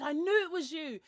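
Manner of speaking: excited recognition. Retold or imitated. Host quoted recognising the speaker.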